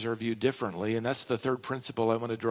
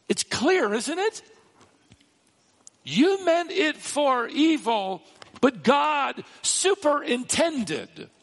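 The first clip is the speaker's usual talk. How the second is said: high, slow declaration, stretching the key words; impassioned preaching; 10 semitones higher, 5 dB louder, slower than usual. Acted. Preacher voicing Joseph to his brothers, impassioned. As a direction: proclaim it loudly and high, word by weighty word.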